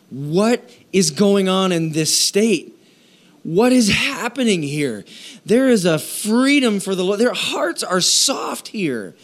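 high, slow marvelling questions; amazed wonder. Retold or imitated. Missionaries marvelling at openness in South Dakota, high slow wonder. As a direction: marvel at what they are seeing.

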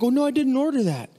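high, flustered protest; startled diner. Retold or imitated. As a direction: fluster like a guest who fears a bill. Guest panics at free water.

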